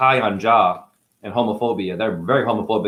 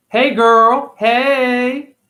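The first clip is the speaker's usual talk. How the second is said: mock high greeting; 12 semitones higher, 6 dB louder, much slower than usual.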